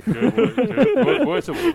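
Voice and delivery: laughing denial. Real, not acted. Laughing denial of bad luck.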